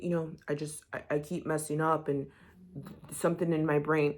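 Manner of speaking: playing him small and sorry for himself; imitating quiet Mecca. Retelling Mecca's reply to Belle, mock quiet and sulky. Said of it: "he gets all quiet".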